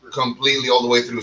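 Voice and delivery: ordinary talk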